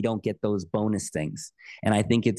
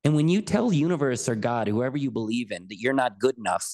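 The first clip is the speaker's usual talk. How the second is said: ordinary talk, nothing marked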